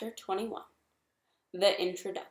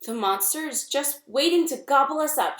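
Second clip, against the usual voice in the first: higher, louder shriek; 5 semitones higher, 6 dB louder than usual. A narrator's reading. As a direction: shriek in panic. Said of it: "shrieked Dig Down".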